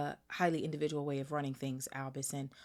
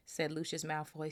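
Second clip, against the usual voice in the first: plain reading voice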